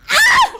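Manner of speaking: high, very loud cry out; short piercing scream; anguished scream. Real, not acted. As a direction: scream in hurt and rage. Betrayed partner screams.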